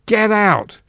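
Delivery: high, loud desperate shout. A narrator's reading. Senator shouting at the wizard to leave, high loud shout. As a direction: throw everything into one shout. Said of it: "mustered all his energy to shout".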